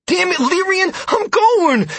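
high loud exasperated yell; irritated shout. A narrator's reading. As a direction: shout back in annoyance. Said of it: "he shouted".

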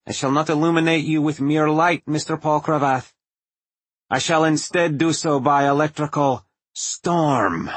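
nasal and theatrical, relishing it; Tesla's nasal voice. A narrator's reading. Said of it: "Tesla's nasal voice".

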